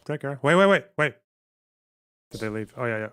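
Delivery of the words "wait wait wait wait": loud and high, wait shouted fast; urgent loud call-back. Real, not acted. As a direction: call them back before they leave. Calling the guest back.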